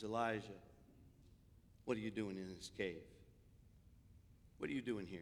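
low, soft voice of God; God's quiet questioning. Retold or imitated. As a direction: ask it softly and low. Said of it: "God comes along and says".

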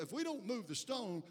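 normal talking voice

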